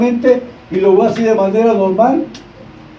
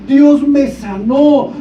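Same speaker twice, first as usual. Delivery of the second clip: higher and drawn out, each word proclaimed; excited proclamation; 4 semitones higher, much slower than usual. Retold or imitated. Healed young man testifies to his pastor, excited. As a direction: proclaim it slowly like an amazed witness.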